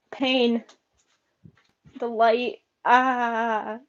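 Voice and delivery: ordinary talk, nothing marked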